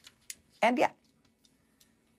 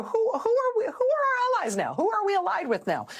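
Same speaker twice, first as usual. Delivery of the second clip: mock bewilderment. Mock bewildered question about allies.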